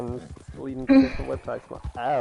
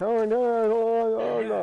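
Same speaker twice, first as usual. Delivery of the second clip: high, loud, drawn-out mock wail; imitated screaming; 9 semitones higher, 5 dB louder, slower than usual. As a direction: mimic distant screaming, slow and high. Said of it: "I heard some screaming".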